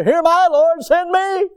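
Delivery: high, loud eager cry; mock fervent cry to the Lord; calling out. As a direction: shout it like a volunteer. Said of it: "we were calling out there".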